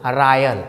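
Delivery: रॉयल said "RAA-yal", low and falling; corrected pronunciation. Shown as a demonstration. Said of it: "तो क्या पढ़ेंगे इसको?".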